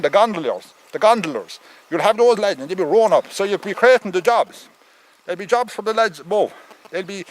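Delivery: ordinary talk, nothing marked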